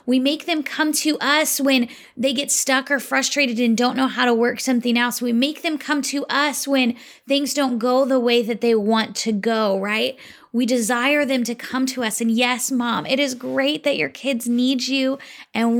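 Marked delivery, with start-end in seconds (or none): none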